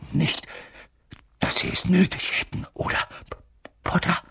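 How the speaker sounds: halting, breathy, broken phrases; nervous stammer. A narrator's reading. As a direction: falter anxiously and stutter on the name. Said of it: "Er lachte nervös".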